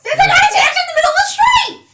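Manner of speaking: high, loud indignant yell; shouting. Acted. Suspicion defended, shouted.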